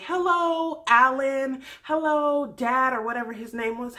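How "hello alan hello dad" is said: high, loud sing-song hello; perky hello. Retold or imitated. Becca greeting the family, high and perky. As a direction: chirp it brightly. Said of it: "she would say".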